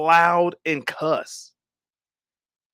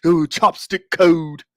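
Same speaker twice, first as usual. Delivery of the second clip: mocking troll voice; 2 semitones lower, 4 dB louder, slower than usual. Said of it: "That's what you got".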